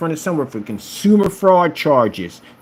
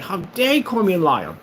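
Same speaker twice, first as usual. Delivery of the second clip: mock indignant; 11 semitones higher than usual. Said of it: "You'll get mad, like".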